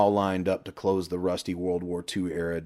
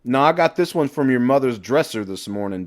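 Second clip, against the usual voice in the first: louder cocky comeback; cocky comeback to the man below; loud proclamation; 6 semitones higher, 7 dB louder than usual. A narrator's reading. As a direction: announce it proudly. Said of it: "Johnny proclaimed".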